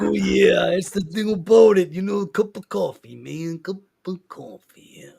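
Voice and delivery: a hint of Macho Man; Macho Man impression. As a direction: sell a cup of coffee like a wrestler. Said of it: "doing the Macho Man impersonation".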